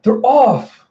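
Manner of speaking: high, loud exclamation; excited call. Retold or imitated. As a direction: call it out like a race starter.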